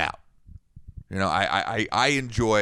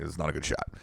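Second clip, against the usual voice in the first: quietly, calmly, much softer; soft inside voice; 8 semitones lower, 8 dB quieter, faster than usual. Retold or imitated. Telling the opponent his shot was bad, in a soft inside voice. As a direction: understate it, barely above a murmur. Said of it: "like, like that".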